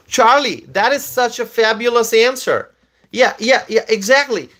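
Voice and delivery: high, excited agreement; delighted approval. Real, not acted. Streamer praising a viewer's comment, delighted and high. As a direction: pounce happily on the answer, pitch up.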